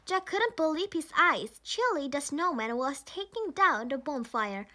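ordinary delivery

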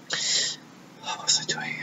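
very soft, slow whisper to oneself; whispered aside. Real, not acted. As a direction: whisper it while trying to remember. Lost track, asking herself.

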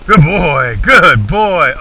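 loud, hearty praise for the dog. Real, not acted. Praising dog after gun shot.

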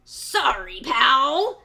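loud mocking fox; smug fox. A narrator's reading. Fox brushing off the piglet. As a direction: brush him off. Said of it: "said the fox".

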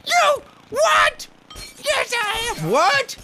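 very high loud squeaky voice; high squeaky girlfriend. Pretend. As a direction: play the jealous girlfriend flake, shrill and shocked. Voicing girlfriend flake character.

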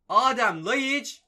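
will the shot in; excited drawn-out call. Real, not acted. Gamer watching Laiç shoot, drawn-out excited call. Chance on goal.

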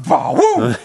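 loud, high mock gunshot blast; shouted bah-woo gunshot sound; gunshot boom. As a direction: imitate the rifle going off. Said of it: "And I went".

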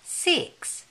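usual speaking voice

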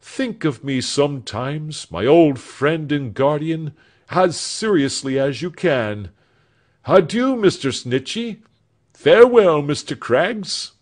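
Alfred voiced with hopeful fervour; earnest young man. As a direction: promise the future with feeling. Alfred's goodbyes to all.

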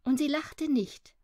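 plain reading voice

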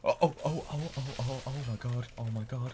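high rapid excited oh my gods; shrieking jackpot winner. Real, not acted. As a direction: babble in rising panic. Panicking at close fight.

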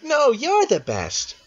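slow, high-pitched cat character. Acted. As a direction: purr it out high and drawn-out. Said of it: "I did a cat for a second".

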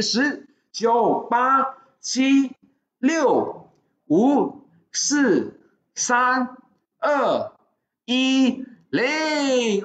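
high, punchy count with a beat between numbers; live countdown. Real, not acted. Host counting down the last seconds of an offer, live countdown. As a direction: call each number out like a closing bell.

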